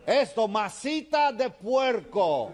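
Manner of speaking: slow, high-pitched bellowed lesson; shouting. Acted. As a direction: shout each syllable at the foreigner. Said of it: "le sigue gritando".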